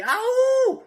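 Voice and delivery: high, loud wolf howl. Acted. Wolf persona howls.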